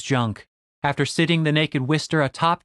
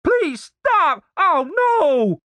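panicked high-pitched begging; frantic wailing plea; desperate; 4 dB louder, much slower than usual. Acted. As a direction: cry out for it to stop. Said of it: "Denise's voice sounded desperate".

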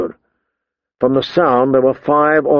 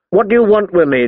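higher-pitched, mildly demanding; John; 6 semitones higher than usual. A narrator's reading. John challenges the boys surrounding him, demanding. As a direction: demand an answer without shouting. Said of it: "John demanded".

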